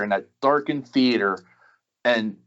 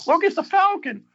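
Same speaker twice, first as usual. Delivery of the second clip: excited cry. Retold or imitated. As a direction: voice leaps far higher in delighted awe. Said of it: "you're like".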